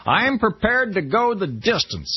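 mock the candidate's slow boast; lightly voiced Flint. Quoting Larry Flint's boast.

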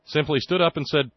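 ordinary talk

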